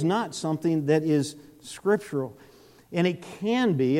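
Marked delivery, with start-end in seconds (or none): 2.9-4.0 "can" set apart with firm emphasis; stress on CAN. Real, not acted. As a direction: lean on the word "can". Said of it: "I stress the word can".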